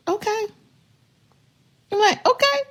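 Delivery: high sing-song dismissive okay; wife brushing off husband's threats with high okays; mock okay. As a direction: chirp okay up high, unbothered. Mocking acceptance.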